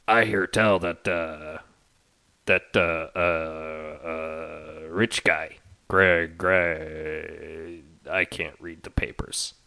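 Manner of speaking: gruff gossip. Acted. NPC gossip.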